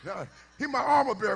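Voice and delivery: ordinary talk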